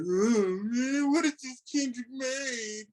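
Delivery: high, slow whiny put-on voice; hammed-up complaining fan voice; mock disappointed fan. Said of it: "I've been reading it".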